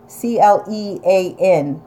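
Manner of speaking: C L E A N spelled slowly letter by letter; spelling it out. Shown as a demonstration. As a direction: spell out C L E A N, each letter separate. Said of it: "not clinging, but clean".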